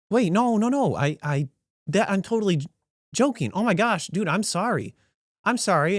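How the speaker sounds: high, stammering backpedal; flustered apology. Retold or imitated. Diner realizes the server wasn't joking, flustered apology. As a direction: scramble to take the joke back.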